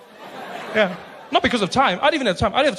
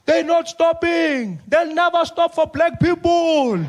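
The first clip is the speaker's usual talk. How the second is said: much higher, slow, panicky plea; panicking aide; 10 semitones higher, slower than usual. Retold or imitated. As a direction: squeak it out like a frightened assistant. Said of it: "Spiwe's on one side panicking".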